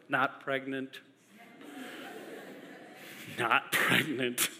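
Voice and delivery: ordinary delivery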